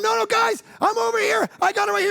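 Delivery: God shouting to his wandering sheep; God calling out. Retold or imitated. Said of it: "he's over here going".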